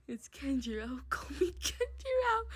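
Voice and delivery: soft whispered, then giggling; hushed intimate line breaking into laughter; whispered character line. Acted. Voicing the love interest.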